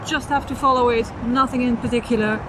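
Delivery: ordinary talk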